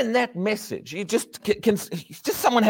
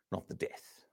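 whisper; 18 dB quieter than usual. Real, not acted.

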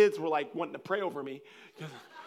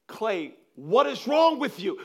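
loud, higher, drawn-out exasperation; imitated mad outburst; 7 semitones higher, 9 dB louder, slower than usual. Daughter demanding answers from her tongue-tied dad, imitated annoyance. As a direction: scold slowly, acting fed up. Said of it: "she was getting mad at me".

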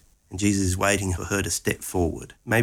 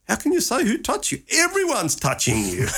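incredulous disciples; 13 semitones higher than usual. Acted. Disciples horrified at question.

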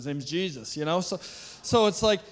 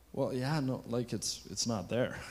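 lower, softer mock voice of a baffled man; puzzled healed man; 7 semitones lower, 6 dB quieter than usual. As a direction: sound unsure, dropping low.

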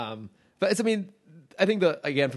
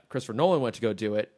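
ordinary talk, nothing marked

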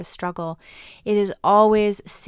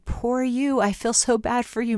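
pitying tone pitched higher, syrupy; a touch of sugary pity, pitched up; mock sympathy; 4 semitones higher than usual. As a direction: coo it like an over-sorry friend. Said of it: "not exactly the ... message".

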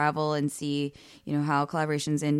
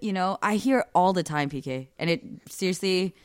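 ordinary talk

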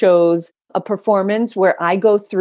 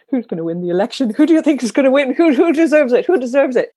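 pushy reporters; 7 semitones higher, 4 dB louder, faster than usual. Retold or imitated. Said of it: "reporters went to this woman and they were like".

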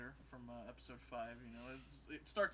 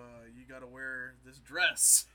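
slow demand; 12 dB louder, slower than usual. Retold or imitated. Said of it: "is like".

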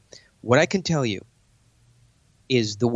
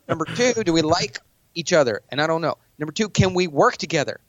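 higher and pushy, jabbing questions; aggressive challenge; 4 semitones higher than usual. Shown as a demonstration. To a billionaire in a pitch meeting, a pushy challenge. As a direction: corner them and demand an answer. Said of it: "pointing to a billionaire".

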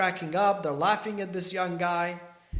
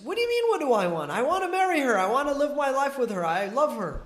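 fast, high and louder, blurting out his longing; a lovesick man who can't hold it in any longer; desperate outburst; 6 semitones higher, 3 dB louder, faster than usual. Retold or imitated. Lovesick gardener bursts out desperately.

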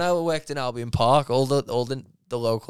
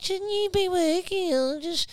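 nosy neighbour; 4 dB louder than usual. Retold or imitated. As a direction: pitch way up and whine it out. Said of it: "the neighbours were like".

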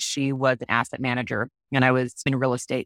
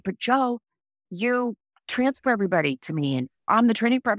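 higher, pleading protest; higher, indignant plea to the manager; protesting self; 6 semitones higher than usual. Retold or imitated. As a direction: argue back to the boss, pitched up. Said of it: "And I was like".

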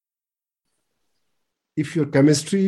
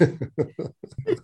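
breathy laugh; 3 semitones higher, 7 dB quieter than usual. Real, not acted. After kindergarten joke.

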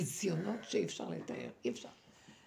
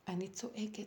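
usual speaking voice

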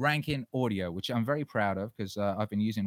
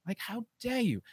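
outraged; 12 semitones higher, 4 dB quieter than usual. Real, not acted. Furious rant at online critics.

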